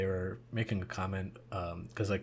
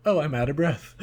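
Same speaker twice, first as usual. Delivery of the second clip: a hint of a hammed-up winded hero, a bit louder; mocking movie character; 6 semitones higher, 9 dB louder than usual. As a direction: poke fun at fake breathlessness. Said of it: "usually it's like".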